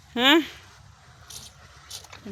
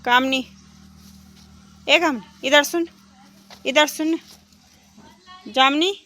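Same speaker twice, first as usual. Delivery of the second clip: loud, high call to the cow; 6 semitones higher, 9 dB louder than usual. Real, not acted. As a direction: call her from a distance to come over. Calling the cow over.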